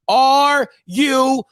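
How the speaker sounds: very slow, stretched-out "are you serious?"; drawn-out catchphrase. Shown as a demonstration. Host announcing his show's catchphrase, slow and stretched. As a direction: drag out "serious" like a show title. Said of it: "show name catchphrase".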